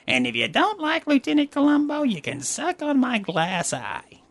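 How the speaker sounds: Lieutenant Columbo impression. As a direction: ham up a scruffy TV detective. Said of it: "I can do Lieutenant Columbo pretty damn good".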